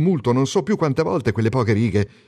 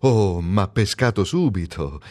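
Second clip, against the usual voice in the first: ordinary delivery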